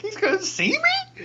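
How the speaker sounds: high, loud, drawn-out squeal; slow incredulous yelp; mock horrified disbelief. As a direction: stretch it out high in comic alarm. Acting out his disbelief.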